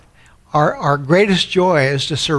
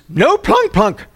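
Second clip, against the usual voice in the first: old lady imitation; 8 semitones higher, 3 dB louder, slower than usual. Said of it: "she says".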